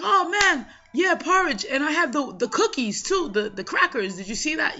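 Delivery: high, eager retelling of a coworker; excited coworker. Retold or imitated. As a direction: coworker gushing about porridge and crackers, voiced high and eager. Coworker excited about porridge crackers.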